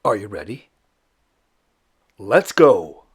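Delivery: loud, eager call to start; rally the audience to set off; excited kickoff. Acted. Host launching the viewers into the hunt, loud and eager.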